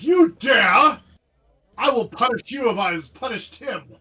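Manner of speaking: high, loud indignant outburst; indignant villain, starting at a shout; outraged villain. Acted. GM voices an outraged villain's threat.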